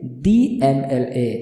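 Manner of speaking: slow "दी MLA", with "दी" said dee. Shown as a demonstration. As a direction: draw out the ee of "दी" before "MLA". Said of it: "उसको दी पढ़ेंगे".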